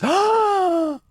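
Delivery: sharp high gasp of joy; overjoyed gasp; high delighted gasp. Acted. Sees rats took over.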